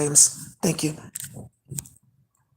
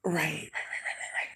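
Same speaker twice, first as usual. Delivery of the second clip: 1 soft murmur; 13 dB quieter than usual. Real, not acted.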